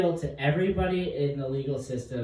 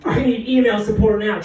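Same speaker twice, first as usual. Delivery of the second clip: higher, louder radio demand; mock urgent call; 8 semitones higher, 8 dB louder than usual. Comic demanding backup over mock radio. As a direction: bark for backup, hammed up.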